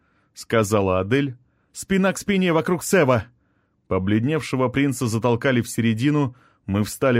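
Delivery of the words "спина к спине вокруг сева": high-pitched rallying call; rally the group in a raised, urgent voice; pressing command, pitched high; urgent order. A narrator's reading. Battle order to protect Sev.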